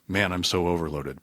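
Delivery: lightly put-on overloaded worker, a bit low; half-hearted impression of someone swamped with work; a hint of a weary, overburdened on-caller; mock overloaded voice. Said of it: "I don't know why I'm using that voice".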